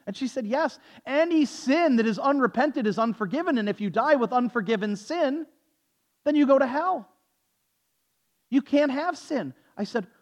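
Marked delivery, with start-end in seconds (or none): 0.5-9.5 high, emphatic imitation of a zealot; raised-pitch retold pronouncement; imitated dogmatic insistence. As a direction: lay down the law in mock earnest. Acting the woman's stern pronouncement.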